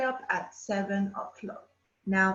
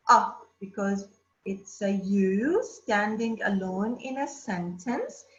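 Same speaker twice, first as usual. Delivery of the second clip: ordinary delivery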